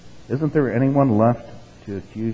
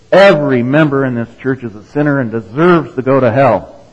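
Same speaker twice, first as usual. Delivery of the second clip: loud fire-and-brimstone roar; thundering preacher; 3 semitones higher, 10 dB louder than usual. Retold or imitated. Pastor thundering damnation at the pews, loud and high. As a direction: thunder it down on the congregation. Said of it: "the pastor thunders again".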